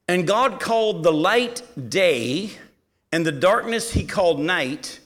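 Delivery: measured, forceful Bible reading; scripture reading. Acted. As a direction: read the verse out deliberately, pausing after each phrase. Reads the verse aloud.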